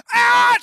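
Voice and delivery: sharp high yell of pain; reenacted pain scream. Fireman yelling as captain pops his toe back. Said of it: "my world just goes from".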